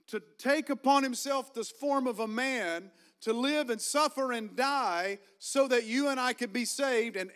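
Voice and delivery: high, loud and slow, fired-up preaching; preaching at full force. Acted. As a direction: lift the church with every word.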